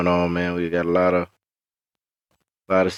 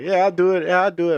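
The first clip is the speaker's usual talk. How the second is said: mock eager yes; 8 semitones higher, 3 dB louder, faster than usual.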